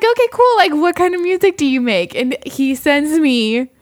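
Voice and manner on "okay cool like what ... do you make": higher, louder faux-enthusiastic reply; mock cheerful. Mimicking her fake-upbeat text to the date. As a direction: play it overly bright and polite.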